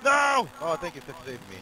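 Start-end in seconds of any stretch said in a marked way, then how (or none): 0.0-0.5 panicked shouting at a dog; panicking gamer. Real, not acted. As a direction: yell high and frantic. Scolding attacking dog in game.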